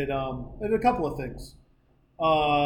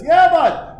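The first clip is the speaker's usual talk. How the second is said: calling back; 11 semitones higher, 11 dB louder than usual. Retold or imitated. Pitcher answering the call.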